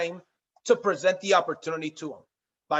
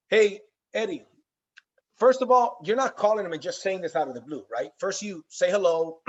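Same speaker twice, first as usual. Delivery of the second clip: ordinary delivery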